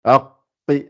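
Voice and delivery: arqui holding the ar; held syllable. Shown as a demonstration. Teacher models how to hold on arqui. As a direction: stay on the ar of arqui. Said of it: "faut rester un peu dessus".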